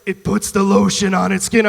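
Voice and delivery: slow, loud movie-kidnapper voice; Buffalo Bill. Acted. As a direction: put on a slow, commanding captor. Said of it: "he'd come in there and be like".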